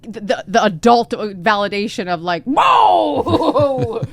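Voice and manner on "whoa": big, high, loud whoa; acted-out amazement; astonished reaction. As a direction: exclaim it long and loud, acting shocked. Acts out wanted adult reaction.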